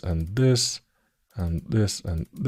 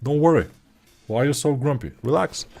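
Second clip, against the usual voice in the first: higher, breezy mock reassurance; mock cheerful reply; 6 semitones higher, faster than usual. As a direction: play the eager employee calming the grumpy bosses. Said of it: "And I said".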